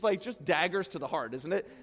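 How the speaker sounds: ordinary talk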